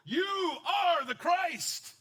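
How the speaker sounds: booming, very slow, high proclamation; hammed-up triumphant declaration; grand proclamation. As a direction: announce it like a herald. Peter's grand confession replayed.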